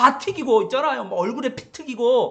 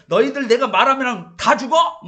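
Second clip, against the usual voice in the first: mock bossy yell; 4 semitones higher, 7 dB louder than usual. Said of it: "소리 뻥뻥 지르면서".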